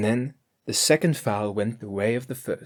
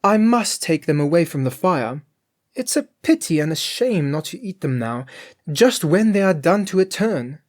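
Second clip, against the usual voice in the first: Grethel's voice; 6 semitones higher, 5 dB louder than usual. A narrator's reading. Grethel to her master.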